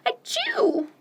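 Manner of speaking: sneeze. Acted. Duck sneezes in story.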